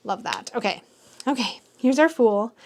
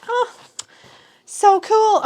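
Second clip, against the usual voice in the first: excited; 10 semitones higher, 5 dB louder, much slower than usual. Real, not acted. Unboxing a signed tarot card.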